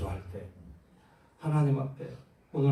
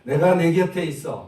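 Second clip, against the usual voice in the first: 내가 네 곁에 있어 said louder and full; 8 dB louder than usual. Shown as a demonstration. Preacher leading the congregation to repeat it louder. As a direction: lead it out loud for the church to repeat. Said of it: "좀 크게 해봐요".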